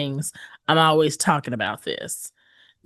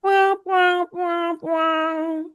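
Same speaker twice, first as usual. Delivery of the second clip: high, drawn-out mock "wah wah" horn; sad trombone imitation; 11 semitones higher, much slower than usual. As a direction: sound the failure horn with your voice. Imitated failure jingle after punchline.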